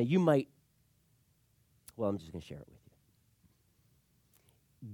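ordinary talk

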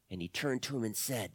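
plain reading voice

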